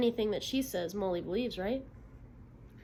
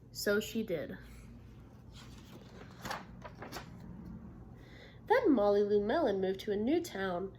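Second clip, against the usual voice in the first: lively read-aloud for a class; storybook narration; story reading to children; 3 semitones lower, 5 dB quieter than usual. Acted. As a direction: tell the story to young listeners. Teacher reads picture book aloud.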